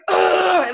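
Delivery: loud, high groan of revulsion; disgusted groan. Real, not acted. Mom recoiling at her old parenting, loud disgusted groan.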